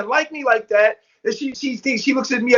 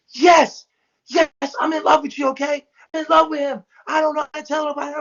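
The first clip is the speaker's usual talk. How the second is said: higher-pitched exasperated outburst; retold fed-up blurting, pitched up; snapping confession; 6 semitones higher than usual. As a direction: imitate someone snapping and admitting it. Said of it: "she starts snapping".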